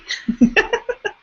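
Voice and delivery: bright high-pitched burst of laughter. Real, not acted. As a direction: laugh it off lightly. Laughs after her own reaction.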